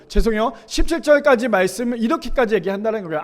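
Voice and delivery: ordinary talk, nothing marked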